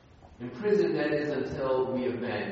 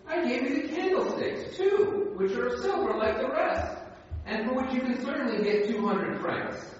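put-on gentle old bishop's voice pitched higher; the kindly bishop; 11 semitones higher than usual. Pastor reading the bishop's lines to the thief, higher voice. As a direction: voice the bishop offering the candlesticks.

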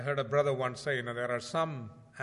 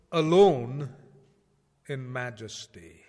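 emphatic title; 8 dB louder, slower than usual. Acted. Title read out.

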